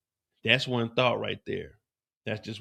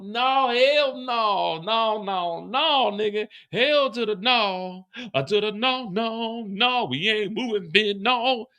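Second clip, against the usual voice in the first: much higher, outraged woman's voice; mock CO woman; 9 semitones higher, slower than usual. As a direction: play the appalled corrections officer, high pitched. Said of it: "She's looking at him like".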